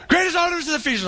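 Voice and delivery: high, fast mob chant; mock crowd screaming. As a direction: imitate a frenzied crowd without full volume. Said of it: "Can you see 'em screaming?".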